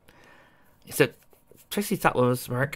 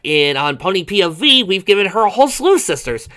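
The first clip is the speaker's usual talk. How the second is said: loud, animated fan commentary; chatty, rising enthusiasm; excited; 5 semitones higher, 10 dB louder than usual. Real, not acted. Host commentary break.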